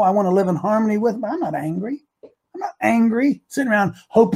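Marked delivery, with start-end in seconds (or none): none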